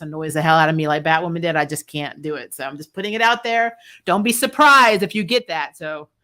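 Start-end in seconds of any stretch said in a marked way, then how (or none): none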